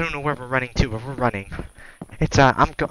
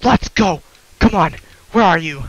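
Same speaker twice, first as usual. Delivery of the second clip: raised, higher battle cry; defiant rallying; 7 semitones higher, 7 dB louder than usual. Real, not acted. Player daring the monster to come out, defiant shout. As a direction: call it out, loud and determined.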